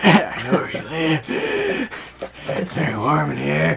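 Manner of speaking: drawn-out, mock-menacing stalker line; creepy put-on voice. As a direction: say it slowly like a playful creep.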